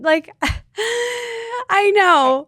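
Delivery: real happy crying, high and loud; choked up with joy; tearful. Real, not acted. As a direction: voice climbing into a tearful laugh. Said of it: "I'm crying too".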